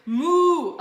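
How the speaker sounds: loud, high moo cutting in. A narrator's reading. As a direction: bellow the moo over her line. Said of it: "Olivia said louder".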